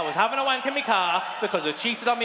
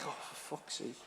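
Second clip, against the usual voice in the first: much softer, lower resigned mutter under the breath; muttered curse; 6 semitones lower, 16 dB quieter than usual. Retold or imitated. Under his breath on finding his wife home, quiet mutter. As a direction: sigh it out to yourself, defeated. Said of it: "And I was like".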